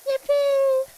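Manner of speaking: high, loud, drawn-out yippee; excited cheer. Real, not acted. Streamer hyping her music video to viewers, excited cheer.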